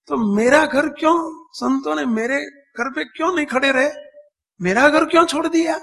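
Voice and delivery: retold old woman's protest, pitched high; high, insistent questioning as the old woman. Old woman asks monks why they skipped her house, questioning. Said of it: "એટલે ડોસી પૂછે".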